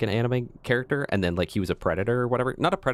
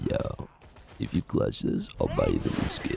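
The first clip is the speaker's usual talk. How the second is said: whisper; 4 dB quieter than usual. Acted. Low whispered bribe.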